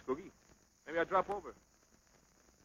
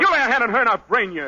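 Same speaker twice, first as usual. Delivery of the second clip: loud, higher-pitched warning; angry threat; 6 semitones higher, 7 dB louder than usual. Acted. Boxer protecting his girl from a rival, loud angry threat. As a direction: threaten him hard, voice raised.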